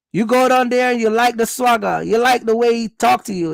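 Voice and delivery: ordinary talk, nothing marked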